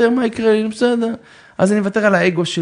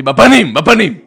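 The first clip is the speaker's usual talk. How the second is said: mock telling off; 9 dB louder than usual. Wife quoted telling off.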